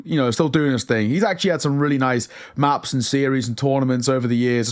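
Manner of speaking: ordinary talk, nothing marked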